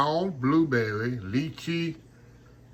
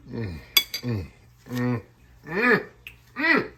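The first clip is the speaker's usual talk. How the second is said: savoring mm; 2 semitones lower, slower than usual. Real, not acted. Cook tasting her own flan, savoring mm sounds.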